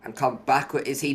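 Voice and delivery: lively live play-by-play call; motorsport commentator. Acted. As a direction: call the overtake as it happens.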